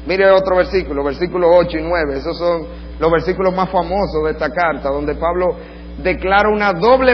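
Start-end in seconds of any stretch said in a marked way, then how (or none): none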